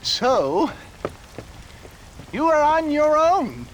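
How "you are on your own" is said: higher, louder, sing-song dismissal; gleeful brush-off. Acted. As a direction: tease him with it.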